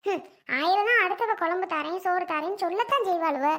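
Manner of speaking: usual speaking voice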